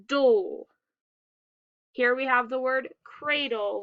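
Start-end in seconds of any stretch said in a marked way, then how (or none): 0.1-0.7 "dole" sounded out on its own, louder; final syllable alone. Shown as a demonstration. Teacher showing what D L E says, syllable sounded out. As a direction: give "dole" as one isolated, falling syllable. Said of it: "D L E says".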